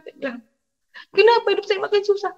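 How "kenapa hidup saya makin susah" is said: high, fast, wailing question; retold tearful lament. Mother behind the boarding house questions God, retold weeping. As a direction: imitate a weeping plea in a rush. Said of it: "Saya menangis di belakang kosan".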